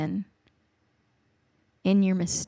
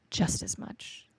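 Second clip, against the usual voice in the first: whisper; 2 semitones lower, 7 dB quieter, slower than usual. Real, not acted. Tender sermon on God's love.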